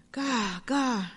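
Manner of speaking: faint, rasping bird croak; weak croak. A narrator's reading. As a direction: croak it out weakly. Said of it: "croaked Raven weakly".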